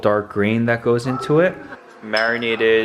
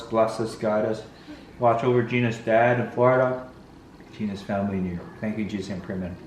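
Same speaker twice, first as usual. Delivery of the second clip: quiet, earnest mealtime prayer; solemn family blessing; 4 dB quieter than usual. Real, not acted. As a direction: pray sincerely over the food. Grace before the meal.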